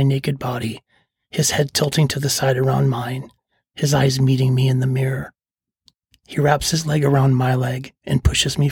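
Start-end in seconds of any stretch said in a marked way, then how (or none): none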